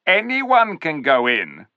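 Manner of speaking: high, loud sneering ape voice; sly ape. A narrator's reading. Ape sneering at the clever dwarfs, high and loud. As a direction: talk down to the dwarfs like a smug ape.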